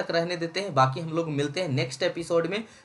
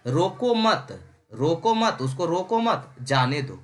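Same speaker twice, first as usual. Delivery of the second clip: रोको मत as one falling unit, then जाने दो; pause after मत; slower than usual. Shown as a demonstration. Teacher explains the second line, letting the guest go, split after मत. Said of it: "लेकिन दूसरा क्या है".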